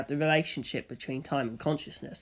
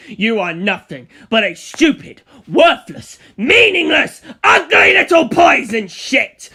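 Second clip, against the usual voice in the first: building from a raised voice to a high, screaming rant; furious insult; 8 semitones higher, 11 dB louder, slower than usual. Acted. Villain screaming his contempt at the enemy, furious. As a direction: spit the insults out, climbing higher and louder.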